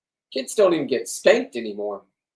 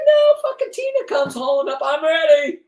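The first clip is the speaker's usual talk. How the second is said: high mock Tina voice; 13 semitones higher, 6 dB louder than usual. Retelling the fishing trip, imitating Tina in a shrill high yell. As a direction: squeal it high and loud like an eager woman. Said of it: "She said".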